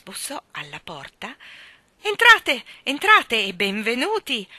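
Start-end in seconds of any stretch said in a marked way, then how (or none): 2.1-4.5 much higher, much louder, slow booming welcome; big cheerful shout of welcome; hearty loud welcome. A narrator's reading. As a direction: call them in jovially. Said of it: "una voce forte e cordiale".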